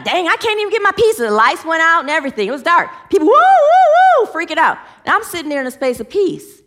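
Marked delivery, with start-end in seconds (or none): 0.0-1.2 frustrated customer. Retold or imitated. People freaking out at storm. 3.3-4.3 high, loud panicky wooing cries; panicked woo. Retold or imitated. People panicking in the dark.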